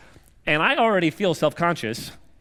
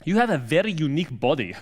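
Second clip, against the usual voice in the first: put-on deep Slavic accent. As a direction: imitate a Slavic gym guy in a deep Slavic accent. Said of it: "in a very deep Slavic accent".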